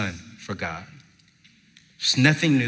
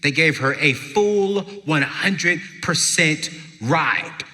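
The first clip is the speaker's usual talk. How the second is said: usual speaking voice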